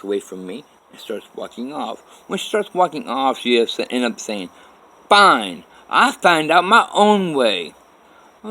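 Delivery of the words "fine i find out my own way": high, loud, slow defiant retort; retold angry retort. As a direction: spit it back as she walks off. Kathy storming off, retold.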